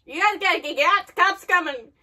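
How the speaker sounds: loud, urgent call-out; urgent warning. Real, not acted. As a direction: warn them to run, raising the voice. Calling out to characters onscreen.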